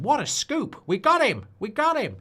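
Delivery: high, excited cry of triumph; gleeful tabloid gotcha. Retold or imitated. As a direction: crow like a paparazzo who caught him. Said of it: "there'll be someone there going".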